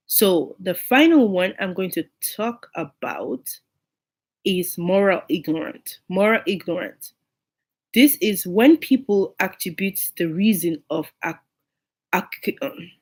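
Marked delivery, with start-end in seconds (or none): none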